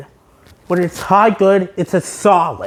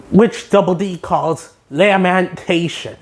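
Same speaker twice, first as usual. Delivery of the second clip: ordinary talk, nothing marked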